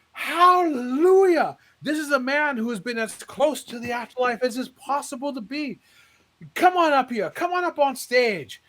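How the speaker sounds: hallelujah cried out high and loud; calling him up with joy; exultant preacher. Retold or imitated. Preacher calling the man onto the stage, high and loud.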